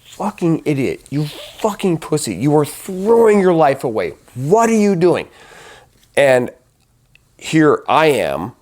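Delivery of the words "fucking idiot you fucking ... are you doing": higher-pitched tirade, building in volume; angry self-scolding that climbs toward a yell; retold furious berating. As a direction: act out tearing into yourself. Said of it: "I'm hitting myself".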